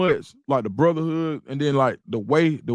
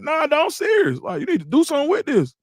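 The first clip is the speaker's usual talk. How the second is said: much higher, pressing him hard; insistent; 12 semitones higher than usual. Retold or imitated. Urging Bucky to release the song, high and insistent. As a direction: push it up high, dead serious.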